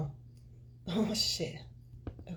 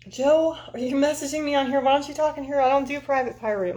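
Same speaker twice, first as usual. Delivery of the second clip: higher and louder, calling out; 9 semitones higher, 6 dB louder than usual. Real, not acted. Calling out to a viewer messaging privately. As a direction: call him out across the room.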